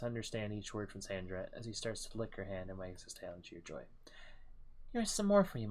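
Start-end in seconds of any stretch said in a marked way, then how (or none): 4.9-5.7 much higher and louder, coaxing a pet. Acted. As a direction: call to the wolf fondly. Character feeding the wolf.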